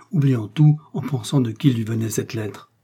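usual speaking voice

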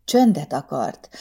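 plain reading voice

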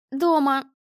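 a touch of a cracking voice; voice breaking. A narrator's reading. Girl answering her mother, voice breaking. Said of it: "срывающимся голосом ответила Лиза".

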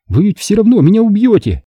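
high-pitched defiant captive; bold. A narrator's reading. Tina answers her captor Grant, knowing he will kill her, boldly. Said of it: "смело сказала Тина".

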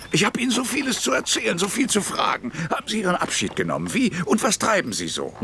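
hastig und deutlich höher; aufgeregt drängend. Acted. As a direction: die Fragen atemlos hervorsprudeln. Aufgeregter Alter bedrängt Pechorin. (Maxim Maximitsch, aufgeregt und schnell)

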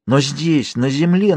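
narrated insistence without added volume; insistent. A narrator's reading. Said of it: "настаивала она".

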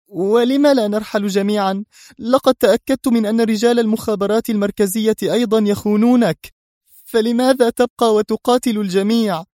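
high, bitter questioning; bitter plea. A narrator's reading. Mona bitterly questioning why he stays, bitter. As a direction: reproach him for staying alone. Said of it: "قالت في مرارة".

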